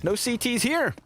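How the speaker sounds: lively esports play-by-play call; play-by-play commentary. Acted. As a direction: call the action as it happens. Play-by-play of the pistol round.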